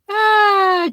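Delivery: a sudden very high, loud ah of delight. Real, not acted. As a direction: yelp with glee at the news. Spotting a viewer's new comment.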